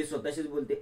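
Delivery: ordinary delivery, nothing marked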